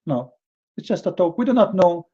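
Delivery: usual speaking voice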